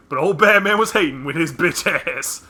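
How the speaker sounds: put-on loud grumpy old man; Granddad Freeman voice. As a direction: bark it like an angry granddad. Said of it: "in the words of Granddad Freeman".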